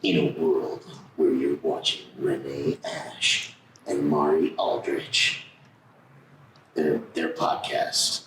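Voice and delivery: very low, slow trailer announcer; movie trailer voice. Shown as a demonstration. Asked for a podcast intro, deep trailer voice. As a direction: drop deep and draw it out like a film trailer. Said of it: "I like your movie theater voice".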